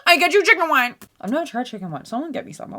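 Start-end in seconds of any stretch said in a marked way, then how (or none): 0.0-1.0 high, loud caricature voice offering drinks; mock hostess voice. Pushing Prosecco and chicken wine on a guest, mock high voice. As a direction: squeal the offers in an exaggerated put-on voice. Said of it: "She's like".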